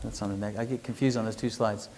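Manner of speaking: soft, low aside while finding your place; under your breath and low; talking to oneself. Real, not acted. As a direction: murmur it to yourself, a little confused. Checking slides, talks to self.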